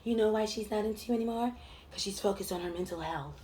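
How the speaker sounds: softened, mock-soothing quote; put-on soft social-media murmur. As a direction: imitate a gentle online platitude. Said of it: "this is what they said on social media".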